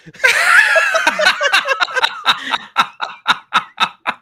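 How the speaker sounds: long, loud, high-pitched laughing fit; real big laugh. Real, not acted. Friend cracked up by own tease, laughing hard. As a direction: burst out laughing and keep going. Teasing about looking like Chris Pratt.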